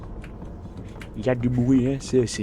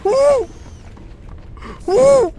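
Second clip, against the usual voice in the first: screaming in fright; 13 dB louder, slower than usual. Real, not acted. Spider scares him.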